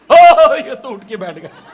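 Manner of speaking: loud startled "oh" that settles quickly; acted shock on a punchline; startled cry. Doctor startled as the patient sits up, loud mock shock.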